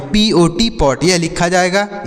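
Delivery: usual speaking voice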